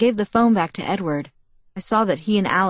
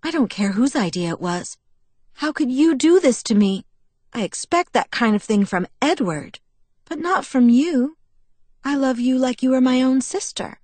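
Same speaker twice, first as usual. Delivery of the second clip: higher-pitched wounded reproach; hurt friend, reproachful; 6 semitones higher than usual. A narrator's reading. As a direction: reproach her, voice climbing with hurt.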